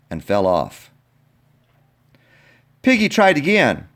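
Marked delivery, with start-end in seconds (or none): none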